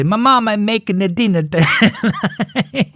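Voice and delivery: put-on high, slow, mangled kid words; imitating a speech impediment. Boy tells friends his mom cooks, slow high mangled words. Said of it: "it was just a speech impediment".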